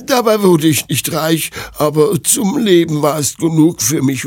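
put-on high old granny voice; contented old seamstress. Old woman recalls sewing for her children, high elderly voice.

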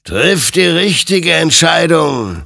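loud, high, drawn-out warning; stern admonition. A narrator's reading. Creature admonishes armed Hector, loud and stern. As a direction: urge it slowly with full force. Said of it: "ermahnte ihn sein Gegenüber".

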